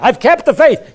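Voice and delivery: high, loud proclamation; mock triumphant declaration. Dying believer claims a finished life, loud mock triumph. Acted deathbed boast of faith.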